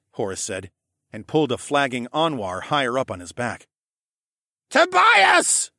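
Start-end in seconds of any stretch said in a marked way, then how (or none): 4.7-5.7 very high, loud cry of a name; sudden scream. A narrator's reading. Jenner screaming out to Tobias, sudden and loud. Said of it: "Jenner's voice suddenly screamed out".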